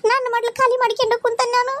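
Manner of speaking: weeping over an empty lap; crying. Acted. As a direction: cry out the loss of her child. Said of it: "ಈಕೆ ಯಾಕೆ ನಮ್ನಿ ಅಳಕತ್ತಾಳ?".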